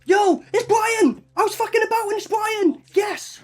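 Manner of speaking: high, loud fan yell, slowed on Brian; thrilled shout. Real, not acted. Fan spotting his favourite actor, yelling with real joy. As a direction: cheer him in like your hero just walked on.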